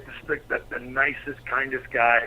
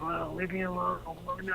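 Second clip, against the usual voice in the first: soft, higher imitation of a woken wife; imitated drowsy complaint, hushed and pitched up; sleepy protest; 4 semitones higher, 10 dB quieter than usual. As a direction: grumble softly as if woken at night.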